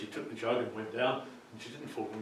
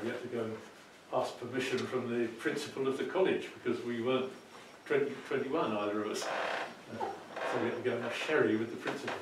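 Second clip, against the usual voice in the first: plain reading voice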